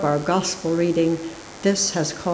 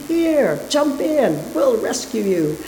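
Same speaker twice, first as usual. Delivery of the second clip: much higher hailing call from a boat; 8 semitones higher than usual. A narrator's reading. Boatmen calling to the man in the window, high hail. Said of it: "call out to him".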